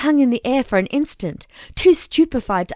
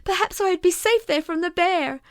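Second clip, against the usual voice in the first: usual speaking voice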